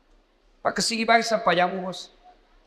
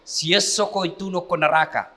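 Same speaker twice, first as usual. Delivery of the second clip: ordinary talk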